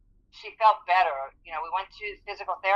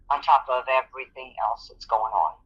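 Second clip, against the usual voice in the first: ordinary talk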